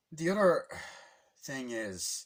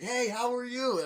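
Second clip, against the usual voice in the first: high, drawn-out called greeting; mock cheery greeting; 11 semitones higher, 4 dB louder, slower than usual. Passing racer hailing another, high slow mock greeting. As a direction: stretch a high hello across the track. Karts greeting each other.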